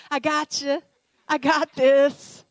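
loud, high, drawn-out exclamation; emphatic shout. Retold or imitated. God reassuring the fearful preacher, emphatic shout. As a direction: shout it out with total assurance, acted.

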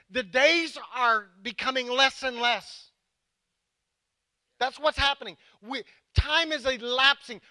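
high, forceful preacher's delivery; urgent preaching. Real, not acted. Pastor warning that time is short, urgent preaching. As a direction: drive each phrase home with weighty pauses.

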